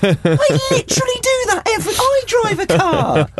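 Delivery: high squeaky mock dad rant; exasperated dad voice. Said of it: "There's dads everywhere going".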